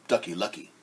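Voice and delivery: normal talking voice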